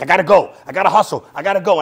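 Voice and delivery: frantic self-urging; rushed hustle. Retold or imitated. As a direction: rattle it off like you're late. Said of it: "'Cause I'm like".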